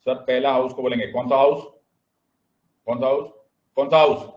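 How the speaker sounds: fast, insistent classroom call-out; rally the class to answer aloud; shouted drill. Shown as a demonstration. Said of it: "सब लोग चिल्ला चिल्ला के बोलेंगे".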